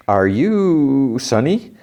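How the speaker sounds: slow, high, stretched-out question; retold wary question. Intruder checking who the shopkeeper is, drawn-out wariness. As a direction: feel your way cautiously into the question.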